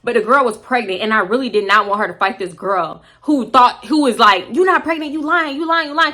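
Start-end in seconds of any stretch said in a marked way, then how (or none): 4.5-6.1 high, rapid-fire mock accusations; mimicked accusing. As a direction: repeat it like a nagging taunt, pitched up. Mimics Pick Me's accusations.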